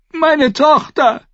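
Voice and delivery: high, loud, slow scream. A narrator's reading. Mother of a girl left in the riot, screaming. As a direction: scream out for your child. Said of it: "schrie Lady Thunder".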